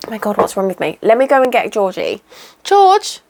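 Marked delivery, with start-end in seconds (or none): 2.6-3.2 high call across the yard. Real, not acted. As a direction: call him over. Calling the goat.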